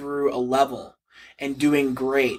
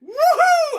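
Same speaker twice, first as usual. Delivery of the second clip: very high loud cheer; cheering woohoo; 5 dB louder than usual. Real, not acted. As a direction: whoop it up. Cheers at fight call.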